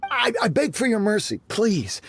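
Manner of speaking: high, desperate plea; begging for mercy. Acted. Guard begging visitors not to tell his master, desperate plea. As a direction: grovel for mercy in fear.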